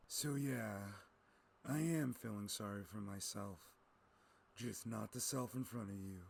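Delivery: a hint of a tired, broken old man, low and soft; weary old man. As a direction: sigh it out like a hero who has given up. Old Jedi's weary confession.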